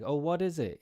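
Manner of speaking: teacher giving a sound-alike for analysis; sound-alike hint. Shown as a demonstration. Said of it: "pronounce it like".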